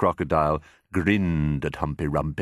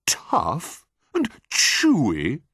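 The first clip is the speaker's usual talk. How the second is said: slow, high mocking echo; incredulous cry; 10 semitones higher, 3 dB louder, much slower than usual. Enormous crocodile scoffs at his companion's complaint, incredulous cry. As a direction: throw it back slowly, stretched in disbelief. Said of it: "cried the enormous crocodile".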